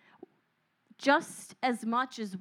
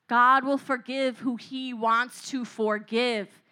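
ordinary talk, nothing marked

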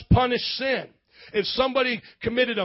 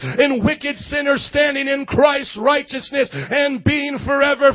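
louder, climactic gospel proclamation; full-force preaching; 3 semitones higher, 7 dB louder than usual. Acted. Preacher proclaiming salvation to the congregation, full-force rising preach. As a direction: lift the church to its feet.

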